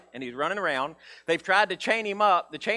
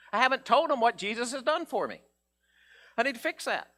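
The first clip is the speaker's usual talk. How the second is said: ordinary talk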